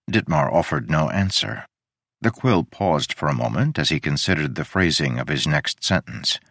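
usual speaking voice

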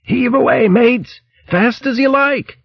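high bellow back to the ship. Acted. Verg bellowing to the crew on deck, high and hearty. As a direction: shout it across the water, pitched high. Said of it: "Verg bellowing back to the ship".

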